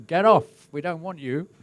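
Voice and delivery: high, loud mock heckle; crowd heckling. Crowd jeering at the organiser on stage, loud mock heckle. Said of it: "they say".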